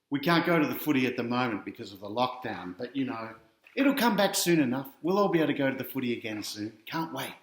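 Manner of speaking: plain reading voice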